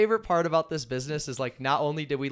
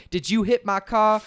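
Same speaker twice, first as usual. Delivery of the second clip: accusing caller; 7 semitones higher, 6 dB louder than usual. Retold or imitated. Quoting the prank call message.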